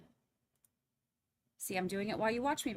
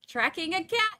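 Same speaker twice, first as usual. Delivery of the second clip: cat said higher and louder with a rise; high emphatic correction; 8 semitones higher, 7 dB louder than usual. Shown as a demonstration. Correcting viewers, high-pitched insistent. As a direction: chirp it up high to set the record straight. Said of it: "Not hurting".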